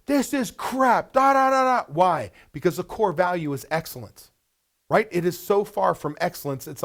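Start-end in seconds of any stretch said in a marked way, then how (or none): 0.0-1.8 high, loud, slow furious rant; angry boss. Retold or imitated. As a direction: lay into the manager, drawing out the words. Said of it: "you lay into me".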